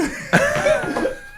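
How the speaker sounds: high-pitched giggle; high laugh. Real, not acted. Still laughing after the joke.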